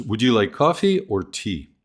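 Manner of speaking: coffee rises, tea falls; either-or question; choice tune. Shown as a demonstration. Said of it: "The intonation informs us".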